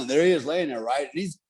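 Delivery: normal talking voice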